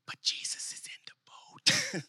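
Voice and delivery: slow, soft whisper; barely voiced, drawn-out comic aside; hushed aside. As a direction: whisper it like an obvious secret. Reader's calm aside against panicked disciples.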